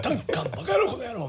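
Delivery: put-on high laughing old man voice; raspy gruff comedian bark; Beat Takeshi impression. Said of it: "おじいちゃんボイスが出せるとね".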